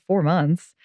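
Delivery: normal talking voice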